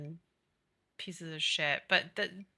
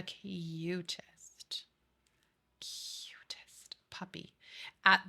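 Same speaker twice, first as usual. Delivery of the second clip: gushing whisper; much slower than usual. Real, not acted. Gushing about a cute puppy.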